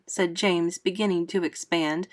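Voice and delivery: ordinary delivery, nothing marked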